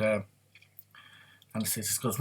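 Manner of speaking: usual speaking voice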